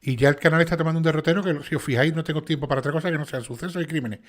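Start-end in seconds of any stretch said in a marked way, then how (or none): none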